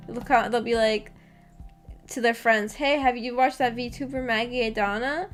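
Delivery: usual speaking voice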